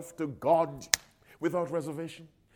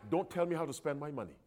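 quiet and fast, a stubborn muttered condition; grudging quick aside; 11 dB quieter, faster than usual. Retold or imitated. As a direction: rush the catch out softly. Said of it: "Here's how some people surrender themselves".

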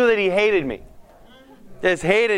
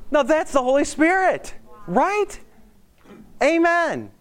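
higher, rising joyful amen; excited preaching; 9 semitones higher, slower than usual. Real, not acted. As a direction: lift it up like a revival call. Preacher celebrates answered prayer.

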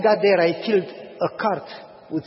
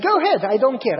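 defiant; 6 semitones higher, 6 dB louder than usual. Retold or imitated. Landlord defiant.

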